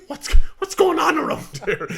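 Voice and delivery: high, loud, indignant shout through laughter; mock indignant shout. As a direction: play the baffled captain yelling. Paul finds the lineup gone.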